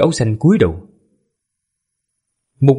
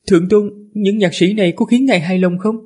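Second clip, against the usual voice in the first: high feminine attendant voice; man doing a woman's voice; 9 semitones higher than usual. Acted. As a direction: voice the servant as a woman, pitched up. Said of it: "Lát ra giả giọng nữ".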